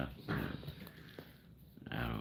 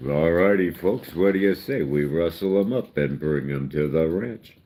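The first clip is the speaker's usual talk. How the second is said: hammed-up Western hero rallying the folks; John Wayne cowboy; 3 semitones higher, 9 dB louder than usual. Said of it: "John Wayne".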